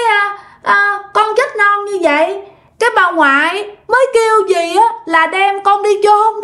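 put-on small child's voice, dragging out the words; tiny child voice. A narrator's reading. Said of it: "nói cái giọng nhỏ xíu". (giọng con nít nhỏ xíu, cao và kéo dài)

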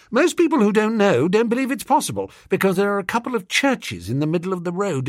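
high, fast, eager rambling toff; babbling on, pleased with himself; rambling young toff. A narrator's reading. Wooster rambling speech to girls.

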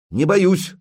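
high, loud, voice wavering; trembling voice. A narrator's reading. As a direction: brave words with a tremble. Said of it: "голосок всё же дрогнул".

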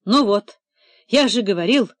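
usual speaking voice

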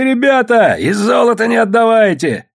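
loud, high-pitched call to the crowd; rallying shout. A narrator's reading. Rebel urging the men to keep their gold, shouting.

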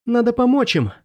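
high-pitched panicked urging; urgent plea. A narrator's reading. Hennon urges help for the fallen fighters, high and panicked.

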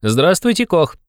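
put-on high-pitched, lively young man's hello; ringing cheerful greeting. A narrator's reading. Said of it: "Звонко и весело закричал подошедший". (звонкое весёлое приветствие)

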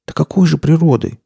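high-pitched, indignant challenge. A narrator's reading. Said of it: "возмутилась Настя".

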